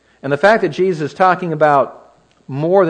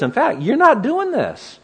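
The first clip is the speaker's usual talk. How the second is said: stubborn refusal; 11 semitones higher, slower than usual. Acted. Peter forbidding Jesus, acted.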